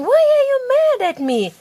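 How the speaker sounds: put-on high-pitched, pleading girl's question; girl asking. Parent reads story girl asking, high-pitched voice. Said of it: "This girl is asking".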